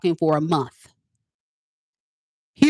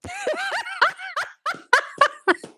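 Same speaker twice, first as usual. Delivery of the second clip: high-pitched helpless cackle; high squealing laugh; 4 dB quieter than usual. Real, not acted. Vlogger cracking up at her friend, squealing laugh.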